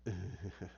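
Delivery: low, soft chuckle; quiet Joker-style laugh; imitated villain chuckle. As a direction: pretend to be quietly amused.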